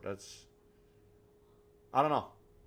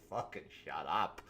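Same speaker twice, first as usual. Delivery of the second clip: muttered annoyance; 6 semitones lower than usual. Retold or imitated. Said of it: "And you're just like".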